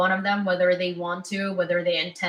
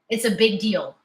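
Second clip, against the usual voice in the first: usual speaking voice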